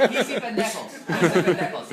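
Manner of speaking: repeating it through laughter; laughing. Real, not acted. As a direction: savour the phrase, still laughing.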